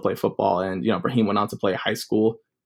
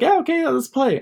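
much higher, bright uncle voice; eager uncle. Retold or imitated. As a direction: play the keen uncle, pitched way up.

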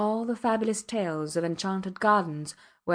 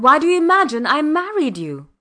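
high, loud, exasperated shout; outraged outburst, pitch soaring; crying out; 8 semitones higher, 11 dB louder than usual. A narrator's reading. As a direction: throw the question out at full voice. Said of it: "he cried".

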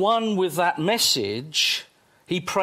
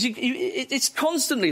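ordinary talk

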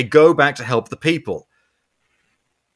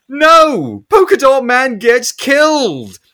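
indignant shout; 7 semitones higher, 5 dB louder, slower than usual. Real, not acted. Outraged movie reaction.

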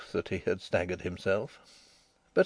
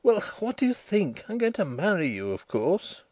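higher-pitched, mildly aggrieved retort; 7 semitones higher than usual. A narrator's reading. Denry announcing he will marry her, understated grievance. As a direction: answer as if put upon, falling at the end. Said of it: "His aggrieved tone".

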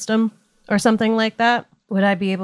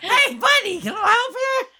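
calling out; 13 semitones higher than usual. Acted. Player character hailing a passerby at the grate: high cheery call. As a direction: call out cheerily to get his attention.

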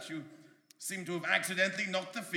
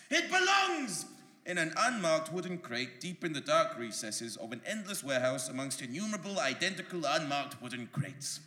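ordinary delivery

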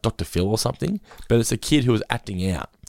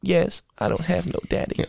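put-on sad little kid; 8 semitones higher, 4 dB quieter than usual.

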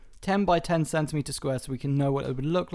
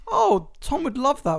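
excited; 9 semitones higher, 4 dB louder, slower than usual. Retold or imitated. Mock quote while shopping.

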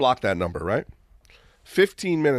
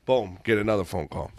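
usual speaking voice

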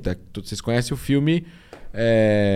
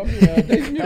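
laughing while talking; 12 semitones higher, 7 dB louder, slower than usual. Real, not acted. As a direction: laugh through the words. Laughing about follower count.

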